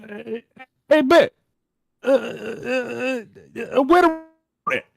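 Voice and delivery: high, loud grandpa impression, full of stammered uhs; yelling papa. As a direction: imitate Papa hollering for Granny. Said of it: "do my papa just yelling at my".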